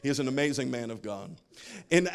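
normal talking voice

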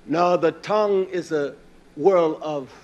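ordinary talk, nothing marked

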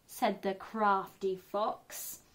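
ordinary delivery, nothing marked

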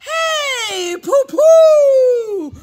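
big, high shouted greeting; loud, whooping hail; calling out. Acted. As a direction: holler a hello, stretching the end. Visitor hails the baby.

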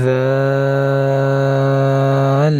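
দাল held very long, slow; drawn-out dal. Shown as a demonstration. Teacher modelling দাল slowly for learners. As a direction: stretch দাল with the tongue at the front teeth. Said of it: "দুই দাঁতের আগার সাথে লাগিয়ে উচ্চারণ".

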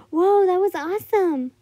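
high, loud, amazed exclamation; thrilled child. A narrator's reading. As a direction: burst out in awe. Child thrilled by the orca sight.

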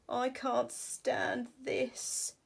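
exasperated bear. Acted. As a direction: drag it out in despair. Mister Bear can't sleep.

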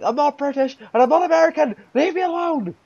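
high, loud retort at his accusers; exasperated protest. Retold or imitated. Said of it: "I'm like".

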